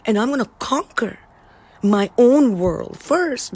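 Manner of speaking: slow, awed declaration of a hero with a sword; lightly voiced hero, slow and awed; inspired inner voice. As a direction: sound like you've just found your power. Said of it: "And you become like".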